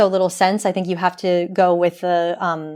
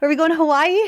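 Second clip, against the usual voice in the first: incredulous; 6 semitones higher, faster than usual. Retold or imitated. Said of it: "I'm like".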